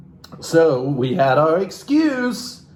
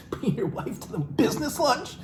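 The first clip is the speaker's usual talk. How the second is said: higher, faster mocking repeat; incredulous mock; 12 semitones higher, 6 dB quieter than usual. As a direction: echo it in disbelief.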